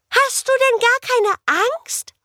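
a wide-eyed little ghost, asking carefully; anxious girl ghost. Acted. Tilla asks the living girl. (hohe, zaghafte Geisterstimme)

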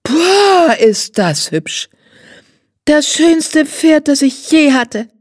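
put-on high, drawn-out child's delight, "Pferd" stretched; a spoiled girl swooning over the pony; high, slow, wide-eyed girl's rapture; gushing little girl. A narrator's reading.

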